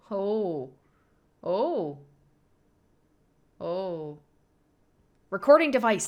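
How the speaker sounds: excited outburst; sudden realisation. Real, not acted. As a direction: shout it out as it clicks. Sudden recognition.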